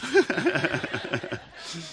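short real laugh at own joke; light embarrassed laugh; amused chuckle. Real, not acted. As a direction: let a chuckle slip out.

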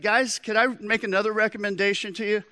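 high, quick, eager offer. Retold or imitated. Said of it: "bigger than life".

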